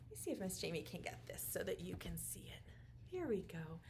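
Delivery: quick aside whisper to a helper. Real, not acted. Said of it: "unvoiced whisper".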